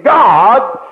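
normal talking voice

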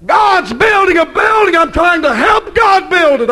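high, shouted preaching; rally the church with a shout; preaching at full force. Acted. Preacher shouts about the building.

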